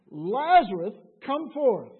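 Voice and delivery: loud, commanding call to the tomb. Acted. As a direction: call him out of the grave with full force. Jesus calls Lazarus from grave.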